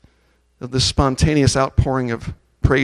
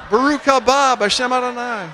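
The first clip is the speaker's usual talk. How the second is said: "Baruch haba Bashem Adonai" shouted high and loud in praise; joyful shout; 10 semitones higher, 4 dB louder than usual. Real, not acted. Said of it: "Keep going".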